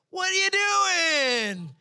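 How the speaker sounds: high, loud, drawn-out indignant yell; imitated vocal outburst. Wife to driver who pulled out, stretched high holler. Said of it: "vocal, but she was like".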